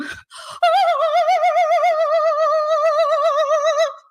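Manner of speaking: long, loud, high-pitched fit of laughter; high giddy laugh. Real, not acted. A streamer bursting into giddy high laughter on camera.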